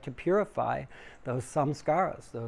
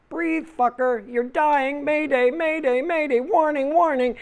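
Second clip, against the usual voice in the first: high, loud panicked alarm; frantic inner voice yelling mayday; panicking reptile brain; 13 semitones higher, 9 dB louder than usual. Pretend. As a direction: shriek it like a blaring alarm. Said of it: "the reptilian brain starts to go".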